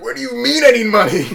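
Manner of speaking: high, loud, laughing protest; yelling it through a laugh; retold outburst. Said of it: "I was like".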